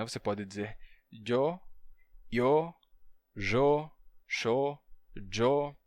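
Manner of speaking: yo said as yo, jo, sho; variant pronunciations. Shown as a demonstration. Teacher listing Spanish yo variants. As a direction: cycle the yo variants. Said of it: "várias pronúncias".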